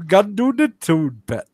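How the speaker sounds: put-on Geordie; Geordie accent. Shown as a demonstration. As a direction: say it like a Geordie local. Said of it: "you've gotta do Geordie".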